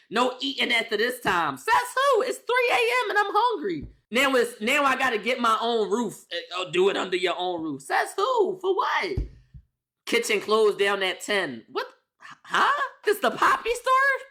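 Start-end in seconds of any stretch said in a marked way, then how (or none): 1.6-3.9 much higher, outraged whine; squeaky indignant protest. Acted. Mock defiance against house rules. 7.8-9.2 much higher, incredulous; squeaky indignant protest. Acted. Mock defiance against house rules. 11.7-14.3 squeaky sarcastic question; high mock disbelief. Mocking the kitchen rule.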